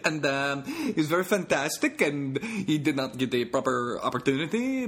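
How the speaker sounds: cheerful all-knowing God bragging; God in a Dutch accent. Pretend. Comic performing his new bit as God, laughing Dutch accent. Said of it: "new joke for you guys".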